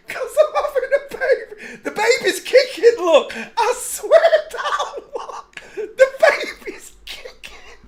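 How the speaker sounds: high, tearful giggling speech; crying with laughter; laughing hysterically. Real, not acted. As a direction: choke out words through laughter. Laughing at pregnant picture.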